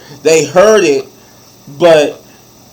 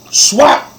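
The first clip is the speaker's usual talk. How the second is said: a sharp, high imitated slap noise; slap sound; 9 semitones higher than usual. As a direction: snap out the sound of the smack.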